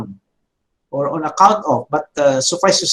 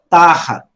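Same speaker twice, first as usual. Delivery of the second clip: tahat with a long, stressed ta; stress on first syllable; 3 dB louder than usual. Shown as a demonstration. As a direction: hold and hit the Ta in tahat, drop off on hat. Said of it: "Notice the pronunciation".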